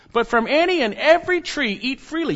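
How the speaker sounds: high, punched delivery of "any and every tree"; emphatic command. Shown as a demonstration. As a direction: proclaim "eat freely" high and emphatically. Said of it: "It's emphatic in God's command".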